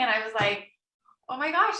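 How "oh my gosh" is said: overwhelmed. Retold or imitated. Crying at the realization.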